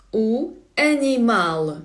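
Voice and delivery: "У animal" slowly, landing on MAL; slow Portuguese ah-nee-MAL in "У animal"; stress on last syllable. Shown as a demonstration. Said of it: "наголос на останній склад".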